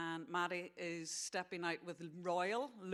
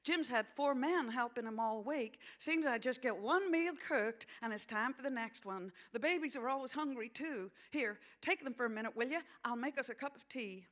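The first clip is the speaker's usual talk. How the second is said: higher-pitched American character voice; harried young mother; 6 semitones higher, 3 dB louder than usual. Acted. Young mother apologizing to visiting friend, high harried voice. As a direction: voice a weary mother explaining herself.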